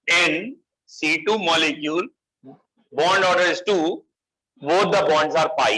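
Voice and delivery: deliberate phrase-by-phrase dictation; slow dictation. Real, not acted. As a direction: dictate in short, drawn-out chunks. Dictating the note once more.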